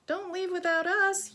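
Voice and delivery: high, loud storybook yell. Acted. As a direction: call after them not to be left behind. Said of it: "yelled the apostrophes".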